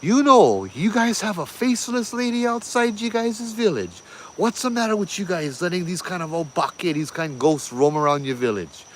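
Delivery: high-pitched, indignant scolding of villagers; angry yelling. Retold or imitated. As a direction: tell them off in outrage, pitch way up. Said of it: "He's yelling at him. He, out of anger".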